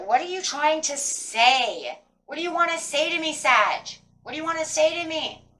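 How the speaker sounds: loud, exasperated prodding of a tongue-tied friend; exasperated calling out. Pretend. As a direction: push them to just spit it out.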